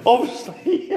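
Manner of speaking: said through a laugh; giggling it out. Real, not acted. Laughing at bad leadership roll.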